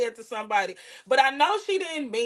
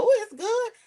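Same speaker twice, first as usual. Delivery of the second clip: "Ooh" swooped up high in fake delight; mock enthusiastic. Pretending to enjoy gum to coax someone, high fake delight. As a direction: sell "Ooh, it's good" with a high swoop. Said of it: "Put one in your mouth and tell".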